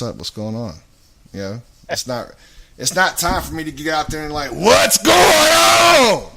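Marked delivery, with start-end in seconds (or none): 4.5-6.3 huge, high, drawn-out holler; ear-busting shout. Shown as a demonstration. Guest speaker blasting the crowd to grab attention, ear-busting shout. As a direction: bellow it and stretch it out to grab attention. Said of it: "I didn't mean to bust anybody's ears".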